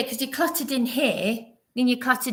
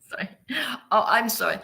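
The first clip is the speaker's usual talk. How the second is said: laughing; much faster than usual. Real, not acted. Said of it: "laughing apology after impression".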